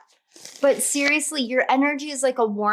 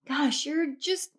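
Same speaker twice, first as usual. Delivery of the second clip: normal talking voice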